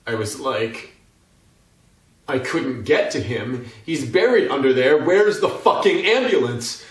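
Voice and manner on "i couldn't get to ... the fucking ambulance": building to a high, desperate shout; frantic shouting. Acted. Cyclist to PR lady about his buried friend, frantic shouting. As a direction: shout in mounting panic for help. Acted frantic plea at crash scene.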